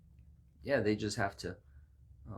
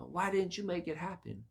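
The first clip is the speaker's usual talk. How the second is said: mad; 9 semitones higher than usual. Acted. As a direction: whine at the idol in mild anger. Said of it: "and then get mad at it".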